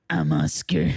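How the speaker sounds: loud, rough stab at a grouchy puppet; Oscar the Grouch. Acted. Podcaster guessing a player's nickname, attempting a grouch impression. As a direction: attempt a grouch impression, not too great. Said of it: "Oscar the Grouch impression".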